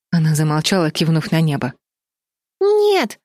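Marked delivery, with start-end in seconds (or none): 2.6-3.2 very high, whiny little voice; child's whimper. A narrator's reading. Чарли refuses to jump from the tower, whimpering. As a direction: whine a refusal like a scared little girl. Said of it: "захныкала Чарли".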